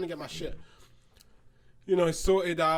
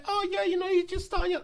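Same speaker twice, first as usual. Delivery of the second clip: mocking everyone; 13 semitones higher, faster than usual. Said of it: "everyone wants to say".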